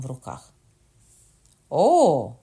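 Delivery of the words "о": like a beast scenting blood; loud eager gasp. A narrator's reading. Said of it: "вимовив Кокона". (гучний збуджений вигук)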